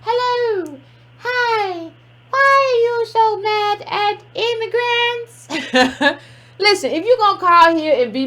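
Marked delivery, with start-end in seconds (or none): 0.0-6.2 high, drawn-out imitation of a fake caller; mock disguised voice. Host mocking a prank caller to listeners, fake high voice. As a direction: mimic a disguised caller in a slow, high singsong. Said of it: "tried to change their voice".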